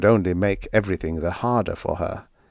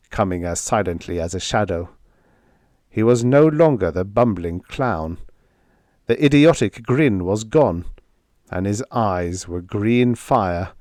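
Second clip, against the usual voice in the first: ordinary delivery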